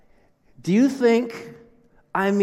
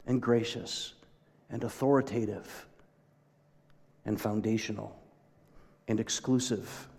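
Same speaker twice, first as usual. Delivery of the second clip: hushed testimony; 3 semitones lower, 6 dB quieter than usual. Retold or imitated. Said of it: "they would have said something like this".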